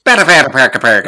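loud, garbled Irish mumble like Brad Pitt in Snatch; hammed-up loud Irish traveller mumble; mumbled Irish accent. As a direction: mangle the words in a thick Irish brogue. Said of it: "that terrible Irish accent".

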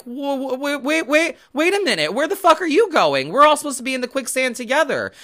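high, squawking alarm at someone leaving; shrill-pitched mock outrage, quickening at the end; mocking jealous crowd. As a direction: mimic the panicked ones left behind. Jealous people mocked, quicksand image.

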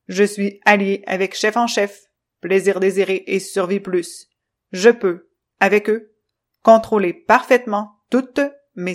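loud, clipped machine voice, stern; choppy robot voice. Acted. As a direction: stiff robot speaking in jerks. Controllo's presentation.

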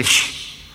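unvoiced "shh" hush; long hissed "shh"; shushing. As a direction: shush a noisy neighbour. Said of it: "Y yo le dije".

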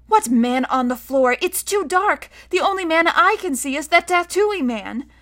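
put-on high, exasperated child's protest; high-pitched irritation with fright underneath; irritated, scared girl. A narrator's reading. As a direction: whine it back, puzzled and cross. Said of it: "Scarlet sounded irritated now, as well as puzzled and scared".

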